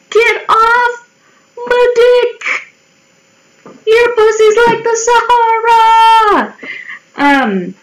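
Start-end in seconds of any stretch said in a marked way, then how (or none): 0.1-6.5 high, drawn-out comic holler; mock outraged yell. Imagined rebuke of the rude man, high mock yell. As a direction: yell it high, stretching the last word.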